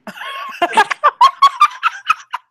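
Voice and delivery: loud, very high shrieking laugh; piercing laugh of delight. Real, not acted. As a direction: let out a screeching cackle. Big laugh at threat.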